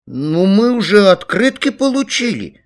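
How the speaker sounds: put-on high, loud childish cry; two brothers clamouring; children shouting. A narrator's reading. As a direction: protest loudly in a kid's high voice. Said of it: "закричали Саласкины".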